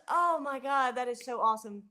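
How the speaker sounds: normal talking voice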